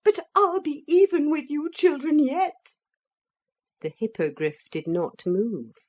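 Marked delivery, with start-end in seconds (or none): 0.0-2.8 high loud threat; vengeful cry. A narrator's reading. Motor lady to the children, vengeful. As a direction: spit the promise of revenge. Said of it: "she cried".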